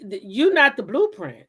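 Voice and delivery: usual speaking voice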